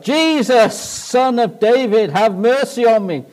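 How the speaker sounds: loud, high booming cry. Acted. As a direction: boom it out over a whole crowd. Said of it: "suddenly this booming voice comes out".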